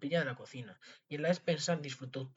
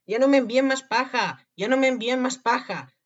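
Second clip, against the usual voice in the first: high, very loud pleading yell; anguished shouting; 10 semitones higher, 12 dB louder than usual. A narrator's reading. Tom Thumb, trapped in the cow, shouting in distress. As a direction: shout it with all your might. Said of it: "decidió gritar con todas sus fuerzas".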